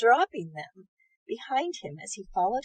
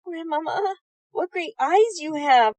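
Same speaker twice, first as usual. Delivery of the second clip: put-on little girl voice; 6 semitones higher, 6 dB louder than usual. A narrator's reading.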